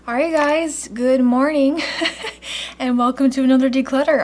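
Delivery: bright, loud and quick; buzzing with caffeine energy; too much caffeine. Real, not acted. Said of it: "I am so caffeinated right now".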